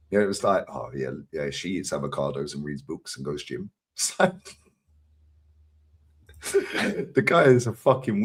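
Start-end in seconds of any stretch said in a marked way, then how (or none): 0.7-3.7 low, quiet imitation of a friend; understated imitation. Quoting his friend about the girl who reads books, understated. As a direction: drop the voice low and soft for his words. Retold deadpan remark by friend.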